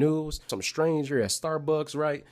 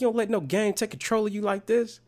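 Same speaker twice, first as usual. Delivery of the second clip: much higher mock mom lecture; a stern mom, imitated pitched up; mom lecturing; 9 semitones higher than usual. Mom lecturing her son at grandma's house, high mock lecture. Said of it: "She was like".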